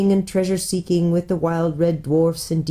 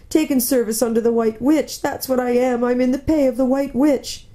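higher, plaintive confession; guilty faun; 6 semitones higher than usual. Acted. Faun confessing service to the Witch, miserable. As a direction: wail the admission in faun voice.

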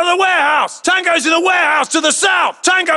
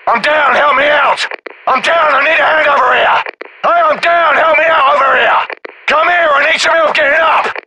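wounded soldier calling; 2 semitones lower than usual. Acted. Downed soldier calling for help.

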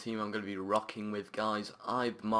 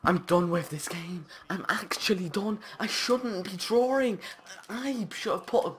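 high, loud frustrated ranting; real frustration, raised pitch; exasperated rant; 9 semitones higher, 4 dB louder than usual. Real, not acted. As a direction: vent at the game. Furious after drawing.

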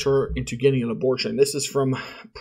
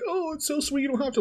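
much higher sweet granny imitation; old lady voice; 13 semitones higher, faster than usual. Guest imitating the old widow, high and sweet. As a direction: play the retiree cheerfully announcing dinner. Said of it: "she was just like".

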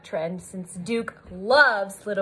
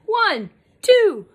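loud, high team call; building shout to heave together; rallying count; 8 semitones higher, 5 dB louder, slower than usual. Acted. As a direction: count everyone in for a big push.

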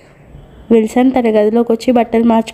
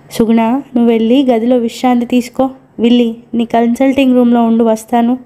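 usual speaking voice

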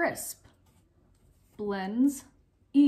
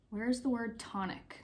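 ordinary delivery

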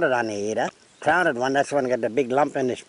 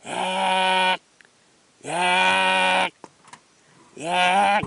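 long, drawn-out higher squawks; perched cockatoo screech; 4 semitones higher, much slower than usual. Shown as a demonstration. Cockatoo standing with spike up, long squawk. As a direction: hold each squawk like a cockatoo. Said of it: "You can see all the hair standing".

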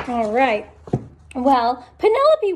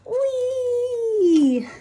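whee; 8 semitones higher than usual. Acted. Sliding down slide.

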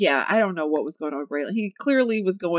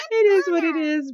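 laughing; 10 semitones higher, 5 dB louder than usual. Real, not acted. Laughing at bored sim.

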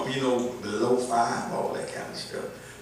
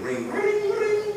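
bell-like ring; slower than usual. Pretend. Chiming the sermon title.

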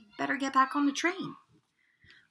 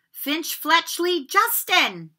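higher, louder, slow name call; 7 semitones higher, 5 dB louder, much slower than usual. Acted. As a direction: draw the name out to the hall. Calling names.

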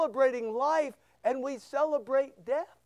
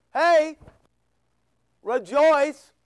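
loud, punchy HEY, then REJOICE; emphatic call; 3 semitones higher, 8 dB louder, much slower than usual. Acted. The Lord urging believers to rejoice, emphatic loud call. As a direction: call it out louder, with a break after HEY. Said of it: "Kinda like the Lord goes".

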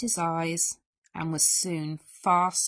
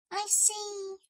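high, soft, subdued little mouse; small mouse voice; 11 semitones higher, 6 dB quieter than usual. A narrator's reading. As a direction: answer meekly in a tiny high voice. Sad Mouse answers dying Bear.

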